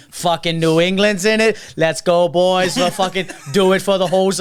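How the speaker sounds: loud Boston fan cheer; rally the team in a mock Boston accent; Jerry. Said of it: "then he's like".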